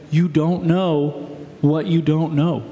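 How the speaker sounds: usual speaking voice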